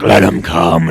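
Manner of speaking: low dwarf war cry; Gimli. Acted. Dwarf daring the goblins to attack, deep defiant voice. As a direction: drop deep into a defiant challenge. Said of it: "Gimli's like".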